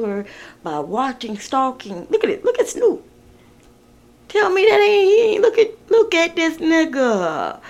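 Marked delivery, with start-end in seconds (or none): 2.1-7.6 amused disbelief. Real, not acted. Reader reacting to a photo of [name].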